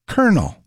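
COLONEL said as KER-nul; shown pronunciation. Shown as a demonstration. Host contrasts spelling with sound, COLONEL as KER-nul. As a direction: say COLONEL like kernel, set apart after a pause. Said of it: "but pronounced".

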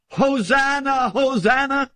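high, slow hosanna cry; crowd crying Hosanna. Acted. Preacher voicing the Palm Sunday crowd, high slow cry. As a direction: lift it up like a hailing crowd. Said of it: "they were crying".

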